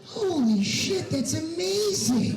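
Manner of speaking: drawn-out gasp of delight; amazed. Retold or imitated. Comic stepping off the plane, slow amazed exclaim.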